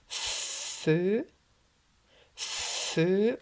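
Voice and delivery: long airy "f" opening each "feu"; "feu" twice, the F stretched; blowing F. Shown as a demonstration. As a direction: blow out the F before the vowel in "feu". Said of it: "qui souffle".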